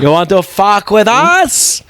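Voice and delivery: high, slow yokel challenge; mock feuding local. Local challenging the outcasts, mock feuding voice. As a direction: challenge them slowly in a put-on high voice.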